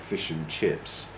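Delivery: fish and chips with and reduced to "n"; weak and. Shown as a demonstration. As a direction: run fish and chips together as "fish 'n' chips". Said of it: "It's usually un".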